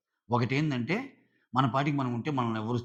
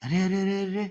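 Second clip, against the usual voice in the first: higher-pitched, mock-concerned string of exclamations; retold dismay, pitched up; concerned reaction to crying; 8 semitones higher than usual. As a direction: imitate a friend lightly fussing over someone in tears. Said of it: "ఏడవంగానే ఇంక రాము".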